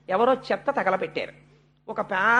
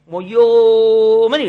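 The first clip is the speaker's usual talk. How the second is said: loud, long-held mock bawl; imitated child's wail; 11 dB louder, much slower than usual. Storyteller showing what the wordless little boy does, imitated loud wail. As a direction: draw out a child's howl, only imitating. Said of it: "వాడు చేసే పని ఏమిటంటే ... ఏడుస్తాడు".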